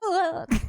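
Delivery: high, pained ugh of disgust; cringing groan at a message. Real, not acted.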